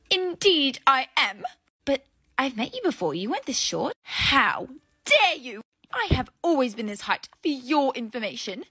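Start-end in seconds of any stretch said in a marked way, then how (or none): 0.1-1.4 slow, grand haughty voice; haughty reply. Acted. Fake celebrity loftily confirming her identity to the doorman. 4.0-7.9 mock outrage. Fake celebrity feigning outrage at the doorman.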